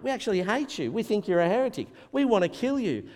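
high-pitched hostile accusation; imitating accusers, agitated and high; hostile mob. Voicing the hostile arresting mob.

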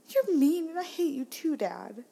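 high mock-child accusation; upset daughter. Daughter turning on her laughing dad, whiny upset. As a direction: hurl it high like a small kid. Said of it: "And she's like".

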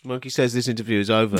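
low, quick deadpan; odd artist with monkey. Pretend. Artist ending the interview for the monkey, low deadpan. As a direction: announce it low and curt.

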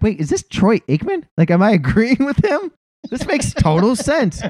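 high-pitched, incredulous mock disbelief; astonished reaction. Host retells catching himself agreeing with Troy Aikman, astonished.

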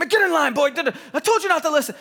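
high, loud order to a pupil; mock school scolding. Public school teacher tells off a boy, mock stern shout. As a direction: imitate a teacher yelling a child into line. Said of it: "the technique sounded a little bit like this".

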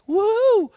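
high rising-falling whoop; high-pitched little whoop. Real, not acted. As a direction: let out a high "woo". Speaker whoops after finishing teaching point.

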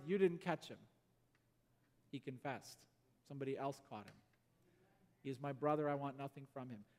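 soft, low, hushed conviction; gentle forgiveness. Retold or imitated. Marius telling the cop he forgives the thief, soft and hushed. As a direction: say it quietly, as a brother.

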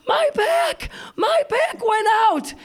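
very high, drawn-out wail of a hurt mom; hammed-up agonized yell; pained cry. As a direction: imitate a mother shrieking in pain. Hurt mother crying out, retold.

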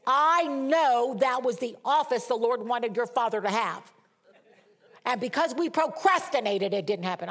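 re-enacted indignant outburst, starting loud and high; worked-up tantrum. Mother venting to her daughter over the lost office, worked-up tantrum. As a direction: act out your own worked-up complaint. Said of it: "I was talking just like this".

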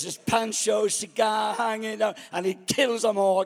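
ordinary delivery

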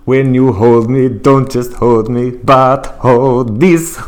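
put-on slow, loud sung Italian accent. Arguing it to doubting co-host, sung Italian accent. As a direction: belt it out slowly with an Italian accent. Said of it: "she absolutely has an It".

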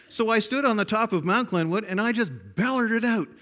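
usual speaking voice

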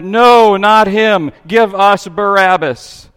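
loud, high crowd yell; crowd shouting. A narrator's reading. Crowd shouts back at Pilate, loud and high. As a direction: shout back as the angry mob. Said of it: "They shouted back".

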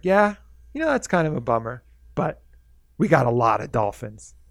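happy dolphin shrugging off human harm; cheery dolphin. Retold or imitated. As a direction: brush off the bad news cheerfully. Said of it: "happiest spirit".

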